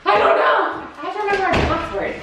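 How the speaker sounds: high, fast, flustered laughing. Real, not acted. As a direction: rush it, flustered. Fumbling login, nervous.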